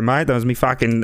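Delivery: ordinary delivery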